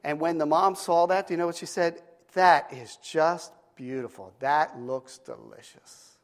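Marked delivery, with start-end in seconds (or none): 2.3-6.2 slow, drawn-out mock marvelling; savoring admiration. Mom praising the little girl's humble sandwich, savoring admiration. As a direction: linger on each word as if admiring a treat.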